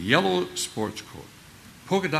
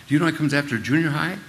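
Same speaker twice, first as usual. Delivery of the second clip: higher, louder questioning adult; quizzing teacher; 6 semitones higher, 6 dB louder, faster than usual. Retold or imitated. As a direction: press the boy with the question. Retold teacher quizzing Jimmy.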